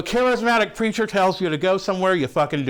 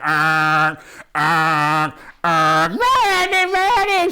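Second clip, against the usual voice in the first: mock panicked screaming; 2 semitones lower, 4 dB louder, much slower than usual. Imitating passengers freaking out as the ship capsizes, mock panic. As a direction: wail the name in hammed-up terror. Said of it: "Everybody's freaking out. They're like".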